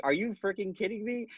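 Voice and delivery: high, quick retold disbelief; incredulous. Asking her about the reelection news, incredulous and high.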